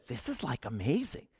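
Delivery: hushed, breathy whisper of awe. Retold or imitated. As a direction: breathe it out barely audible. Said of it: "I remember whispering these words".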